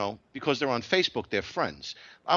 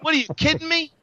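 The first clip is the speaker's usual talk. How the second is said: loud, higher, furious rant; angry rant; 8 semitones higher, 8 dB louder than usual. Real, not acted. Host rants to listeners about Facebook friends: loud furious rant. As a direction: tear into them, shouting.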